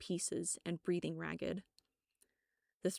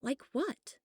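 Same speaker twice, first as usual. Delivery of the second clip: confused; 8 semitones higher than usual. Acted. Narrator confused question.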